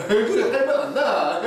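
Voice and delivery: loud, high Gyeongsang lilt with a laugh; Gyeongsang dialect. Shown as a demonstration. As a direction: answer in broad Gyeongsang dialect, amused. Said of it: "이러는데 경상도에서". (웃음 섞인 크고 높은 경상도 사투리)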